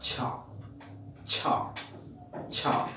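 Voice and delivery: ছা said softly with breath slowly released; affricate demo. Shown as a demonstration. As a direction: let the air slip out gently on ছা. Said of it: "IPA তে এটাকে বলা হয়". (আস্তে বাতাস ছেড়ে ছা)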